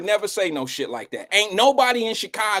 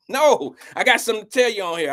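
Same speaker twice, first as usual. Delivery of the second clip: usual speaking voice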